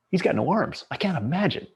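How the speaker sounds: disbelief. Retold or imitated. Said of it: "I'm going".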